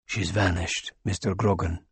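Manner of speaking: low, soft and regretful; doctor softly telling a dying man no; quiet apology. Acted. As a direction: let him down gently, low and soft.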